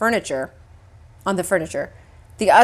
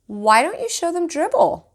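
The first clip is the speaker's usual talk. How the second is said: higher, gentle suggesting mother voice; 9 semitones higher than usual. A narrator's reading. Mother suggesting Peter show the kids his pet, lightly higher voice. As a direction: nudge him, hopeful. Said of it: "my mother suggested".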